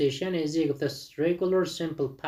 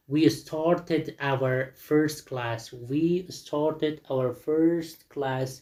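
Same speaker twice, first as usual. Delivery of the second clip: very slow, word-by-word teacher's reading; slow listen-and-repeat delivery; model for repetition; much slower than usual. Shown as a demonstration. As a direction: draw the sentence out for students to copy, "started" in two syllables. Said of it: "listen repeat the sound and sentences".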